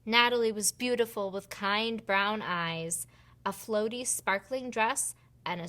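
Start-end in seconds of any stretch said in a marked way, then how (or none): none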